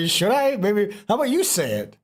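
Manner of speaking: playful high teasing. Real, not acted. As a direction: coax them, grinning. Teasing friend to say word.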